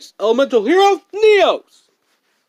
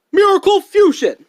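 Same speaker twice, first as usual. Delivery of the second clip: loud, higher battle call; called out card name; 7 semitones higher, 4 dB louder than usual. Acted. Duelist proudly calls out his spell card. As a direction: shout the card name like a hero.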